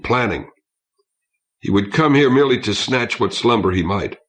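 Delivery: plain reading voice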